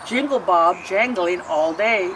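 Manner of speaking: plain reading voice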